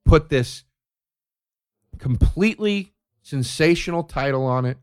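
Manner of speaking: ordinary talk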